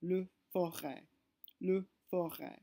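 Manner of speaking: forêt as fo-RAY, no S sounded; French word. Shown as a demonstration. Teacher showing the circumflex word, French pronunciation. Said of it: "the word for forest".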